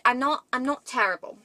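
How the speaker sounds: ordinary talk, nothing marked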